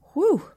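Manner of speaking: loud exhale of relief; relieved whew. Real, not acted. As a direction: blow out a big relieved whew. Relief after dark story.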